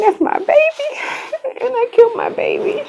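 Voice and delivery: very high, thin sobbing words; breaking down crying. Real, not acted. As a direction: squeeze the words out through real tears. Breaks down weeping for her baby.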